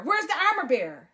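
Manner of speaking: ordinary delivery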